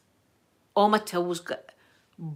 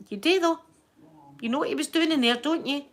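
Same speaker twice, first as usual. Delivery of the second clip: put-on higher knowing older woman; insistent mum voice; 7 semitones higher than usual. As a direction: press the point like a nosy mum.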